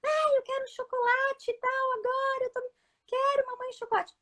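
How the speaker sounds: put-on high, whining little girl begging for chocolate; whiny child. Toddler whining to mom for chocolate. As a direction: play the toddler who wants sweets. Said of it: "Eu não tô dando pra ela".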